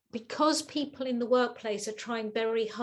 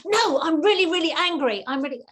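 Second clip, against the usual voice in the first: higher and louder mock outburst; the caller flares up again, imitated; angry caller; 5 semitones higher, 7 dB louder than usual. Caller insisting to the agent, mock fury. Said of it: "This person was saying".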